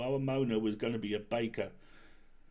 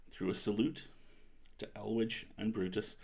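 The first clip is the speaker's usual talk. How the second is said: plain reading voice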